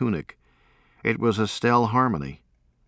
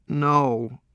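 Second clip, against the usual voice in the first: high, breaking cry on the word; a patient's voice cracking into tears; starting to cry; 8 semitones higher than usual. A narrator's reading. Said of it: "she said and started crying".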